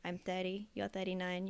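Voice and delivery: normal talking voice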